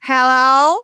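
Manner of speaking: rising, half-laughing granny hello; granny's phone hello. Retold or imitated. Granny answering the phone, lightly imitated with a laugh. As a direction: pick up the phone like granny did. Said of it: "She would always answer the phone like this".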